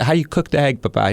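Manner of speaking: high, mock-innocent question; teasing interrogation; sarcastic. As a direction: needle him as if innocently curious. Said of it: "sarcastic line of questioning coming his way".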